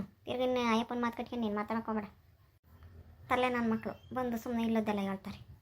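quiet disapproving aside. Acted. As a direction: grumble it softly, brushing the gossip aside. Acted dismissal of a neighbour's report.

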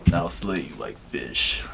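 deep, menacing, growly villain voice; low menacing line delivery; Gojo voice. Pretend. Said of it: "Give me a line".

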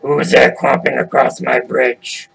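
slow troll challenge; menacing troll. Acted. Troll challenges goat crossing his bridge, slow and threatening. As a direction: growl it slowly like a bridge troll.

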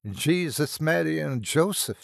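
startled Irish exclamation, pitched high; an Irish oath in sudden shock; full Irish accent. A narrator's reading. Shocked nurse crosses herself, full Irish accent flaring up. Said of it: "her full Irish accent flaring up".

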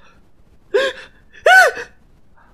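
very high loud gasp scream; high gasping shriek. Real, not acted. Streamer startled by the game, gasping shriek.

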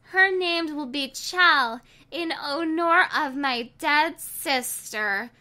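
high, slow tender announcement; name her with loving solemnity; tender naming. Acted. Mother names her baby after dead sister, tender.

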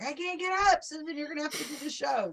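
a hint of a stranded man pleading; stuck in a chair. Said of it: "he was sitting there just like".

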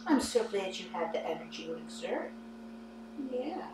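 soft, hushed soothing coo; soft pet talk. Real, not acted. Owner praising her tired pet after playtime, softly cooing.

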